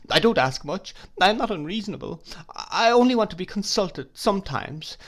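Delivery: high, fast, tearful pleading. Acted. Tearful wife begging her husband to consult her. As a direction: plead through tears. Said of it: "with many tears".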